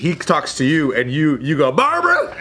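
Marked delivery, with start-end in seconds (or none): 1.7-2.4 very high laughing Southern yell; mock Southern call. Friend imitating his Southern accent bit, shrill call. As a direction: shriek the name like a Texan belle. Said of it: "you do this accent".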